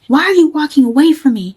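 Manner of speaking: imitating boyfriend's angry shout; mock loud yell. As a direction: yell it the way he did at the mall.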